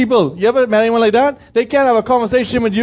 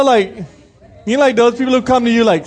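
normal talking voice

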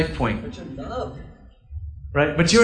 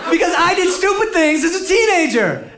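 laughing; 11 semitones higher than usual. Real, not acted. Laughing at himself.

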